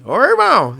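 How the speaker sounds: high, loud, laughing call of Ô, irmão; pastor's hearty greeting. Retold or imitated. Pastor phoning Guilherme in the morning, loud hearty greeting. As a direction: boom out Ô, irmão like a jovial pastor on the phone. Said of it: "Ele fala assim".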